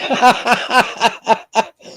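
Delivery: long, high-pitched peal of laughter; helpless high cackle trailing on; real laugh. Real, not acted. Host cracks up at joke.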